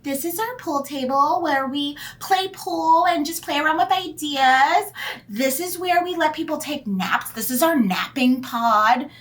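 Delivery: high, showy company tour voice; hammed-up high host, stretching the ends of phrases; mock office tour guide. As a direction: imitate a perky workplace showing off its perks. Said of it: "people who work at places where they're like".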